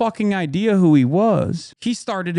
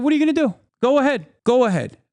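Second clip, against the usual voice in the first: high, goading retort; confrontational dare; 9 semitones higher, faster than usual. Retold or imitated. Daring the man still filming to act, confrontational. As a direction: goad him to make a move.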